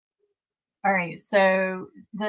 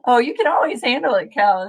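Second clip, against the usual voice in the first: 1 loud teasing; 4 semitones higher, 8 dB louder than usual. Real, not acted. Teasing a chatter loudly.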